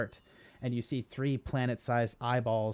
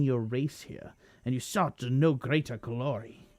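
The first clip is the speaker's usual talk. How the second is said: villain taunt; 5 dB louder than usual. Acted. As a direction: sneer at the party's failure.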